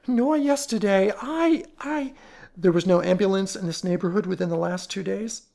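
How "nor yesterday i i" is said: frightened stammer. Acted.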